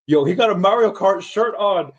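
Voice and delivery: high-pitched amazed call-out; excited onlooker. Retold or imitated. Other dude spots the Mario Kart shirt, imitated excited.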